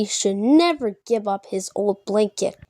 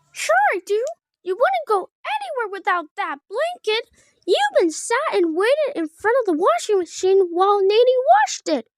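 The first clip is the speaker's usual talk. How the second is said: put-on much higher, childlike bear voice; Fozzie the bear; 10 semitones higher than usual. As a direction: play a delighted little Fozzie. Fozzie teases Kermit.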